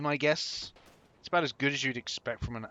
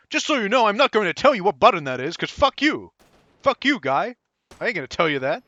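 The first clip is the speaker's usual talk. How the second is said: mocking the game's voice; 7 semitones higher, 10 dB louder than usual. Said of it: "But guess what?".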